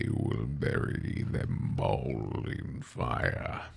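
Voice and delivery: low, slow menacing growl; slow ominous demon threat; deep villain voice. Pretend. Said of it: "Why is my voice so deep today?".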